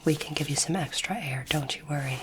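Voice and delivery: normal talking voice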